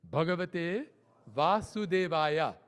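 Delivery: slow, measured mantra recitation; deliberate, drawn-out Sanskrit syllables. Shown as a demonstration. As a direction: draw out each syllable so it can be counted. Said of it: "starts with twelve syllables".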